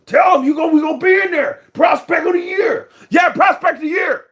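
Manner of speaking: high, loud hype announcement; mock hype shout; hyped father and son. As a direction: sell it like a proud boxing dad. Dad and kid hype answer.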